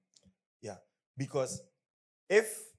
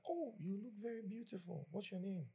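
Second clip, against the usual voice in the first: high, soft, admiring compliment; sugary flattering voice; sweet-talking charmer; 9 semitones higher, 8 dB quieter than usual. Retold or imitated. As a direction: coo it like a smitten suitor. Pastor acts himself flirting.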